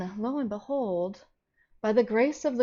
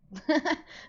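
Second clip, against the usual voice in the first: bright laugh; 7 semitones higher than usual. Real, not acted. Laughs greeting chat.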